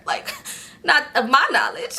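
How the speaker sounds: laughing through the words. Real, not acted. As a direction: giggle out the excuse. Laughing at her own mistake.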